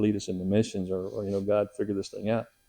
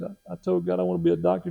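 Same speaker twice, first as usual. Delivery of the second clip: put-on tearful little boy; 8 semitones higher than usual. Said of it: "he got tears running his face".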